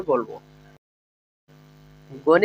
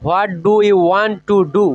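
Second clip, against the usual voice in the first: each word spoken slowly and clearly for learners; teacher modelling a question slowly; slow, careful English; 3 dB louder, much slower than usual. Shown as a demonstration. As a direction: lay out every word so students can copy it. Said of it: "এইভাবে বলবো।".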